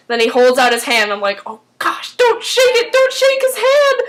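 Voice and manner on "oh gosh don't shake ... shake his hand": very high-pitched hammed-up panic; alarmed warning, re-enacted. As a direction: warn a character frantically, pitch shooting up. Reacts in alarm to the screen.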